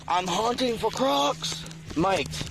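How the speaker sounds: high, slow put-on Australian accent. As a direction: play an Aussie croc hunter in a broad Australian accent. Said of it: "I apologize now for my accent".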